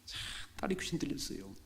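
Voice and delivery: whispered, secretive retelling. Shown as a demonstration. Preacher repeating the line to the congregation in a whisper. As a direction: drop to a hushed whisper. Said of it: "whispered repeat of the line".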